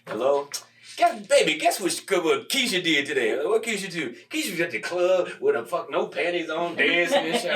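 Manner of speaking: lightly voiced gossiping girlfriend; acted phone call. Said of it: "And so I acted out".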